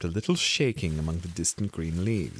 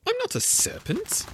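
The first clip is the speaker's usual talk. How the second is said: much higher, NOT pushed hard; indignant. A narrator's reading. Alice protests to the pigeon, high and indignant. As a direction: protest like an offended girl. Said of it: "said Alice indignantly".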